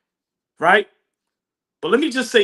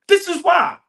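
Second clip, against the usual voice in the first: emphatic; 8 semitones higher than usual. Shown as a demonstration.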